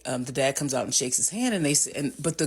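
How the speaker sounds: ordinary talk, nothing marked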